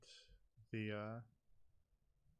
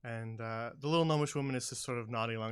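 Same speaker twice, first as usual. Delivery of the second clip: ordinary talk, nothing marked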